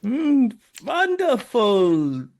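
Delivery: loud, high, slow gushing praise; an old baker overjoyed by their work; the baker gushing to the girls, loud and slow; delighted. Acted. Baker praises bad baking.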